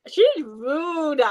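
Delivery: usual speaking voice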